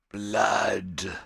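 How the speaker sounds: a loud rasping whisper of one word; hoarse whispered shout. Acted. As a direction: hiss it out hoarse and sharp. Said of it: "Two hoarse whispers delivered".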